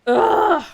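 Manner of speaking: loud exasperated groan; high loud groan of annoyance; exasperated ugh. Real, not acted. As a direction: groan it out, fed up. Exasperated groan at chatty friend.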